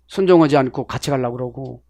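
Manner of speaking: normal talking voice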